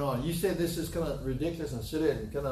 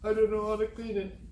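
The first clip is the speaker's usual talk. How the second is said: high, put-on helpless child voice; hammed-up pathetic little boy; real pathetic; 7 semitones higher than usual. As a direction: imitate a pitiful kid pleading, pitched up. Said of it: "Real pathetic, real".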